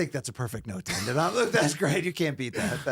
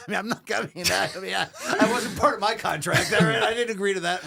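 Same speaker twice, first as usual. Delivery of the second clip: talking through laughter; laughing; 5 semitones higher, 4 dB louder than usual. Real, not acted. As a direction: laugh while protesting. Jokes while laughing hard.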